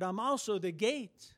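ordinary talk, nothing marked

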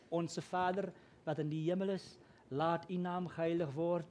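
"Onse Vader, wat in die hemel is, laat u naam geheilig word" said in Afrikaans; Afrikaans prayer. Shown as a demonstration. Pastor recites the childhood Afrikaans Lord's Prayer, Afrikaans pronunciation. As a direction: recite it as learned in Afrikaans preschool. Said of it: "which is Afrikaans".

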